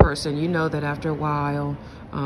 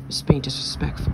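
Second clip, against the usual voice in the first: whispered again. Retold or imitated. Narrator whispers the mother's rule again, mocking. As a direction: breathe the phrase under the breath. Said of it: "repeat in whisper".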